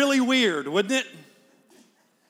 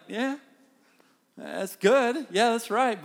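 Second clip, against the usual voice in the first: higher, mildly humoring reply; God unimpressed, indulgent; 4 semitones higher, slower than usual. Retold or imitated. God answering a believer showing off devotions, mildly indulgent and unimpressed. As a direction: lightly play someone politely humoring a show-off. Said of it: "And God's like".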